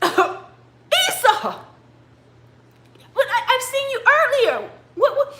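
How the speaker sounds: very high, comic bewildered voice; bewildered old father. Acted. Blind father puzzled at seeing his son twice, high bewildered voice. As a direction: squeak out your confusion, stammering.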